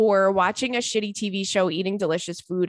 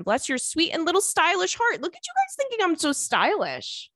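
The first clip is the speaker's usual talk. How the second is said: delighted and flattered; 10 semitones higher than usual. Real, not acted. Flattered by listener compliments.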